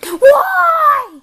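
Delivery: high, loud hammed-up question; exaggerated curiosity. Friend asking for the joke's answer, exaggerated eagerness. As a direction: demand the answer with overblown interest. Setting up a joke.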